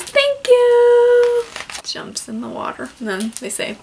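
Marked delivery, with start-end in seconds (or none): 0.0-1.4 high, loud cheerful thanks; grateful bear. Acted. Little bear thanking the pigeons, high and loud. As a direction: chirp it up bright and high. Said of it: "And then he says".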